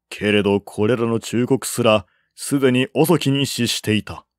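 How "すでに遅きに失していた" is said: ordinary delivery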